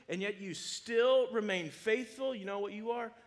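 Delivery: loud, high-pitched pulpit fervor; full-force preacher's call; fiery preaching. Acted. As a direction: preach it out to the whole room, rising.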